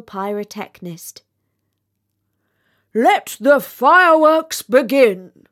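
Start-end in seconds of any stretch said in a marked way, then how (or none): 2.9-5.5 slow, much louder kingly proclamation; royal command. Acted. The king commanding the display, loud and slow. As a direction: proclaim it grandly to the court. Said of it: "said the king".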